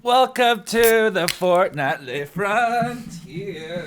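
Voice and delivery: slow, high, booming intro; announcer voice. Acted. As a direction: hype it up like a showman. Over-the-top podcast intro.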